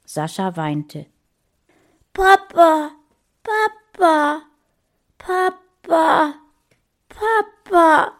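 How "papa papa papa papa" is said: put-on high, loud child's cries for his father; crying boy calling for his lost father. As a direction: call desperately for papa. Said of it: "rief er".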